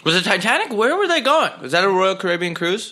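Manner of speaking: high, loud, incredulous questions; mock baffled. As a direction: play dumb, amazed. Mock confusion about the Titanic.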